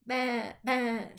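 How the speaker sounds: high bleating baa in a sheep voice. A narrator's reading. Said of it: "said Pete".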